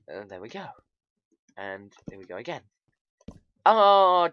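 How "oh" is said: high, loud dismayed groan. Real, not acted. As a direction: groan at hitting a dead end. Hits a dead end.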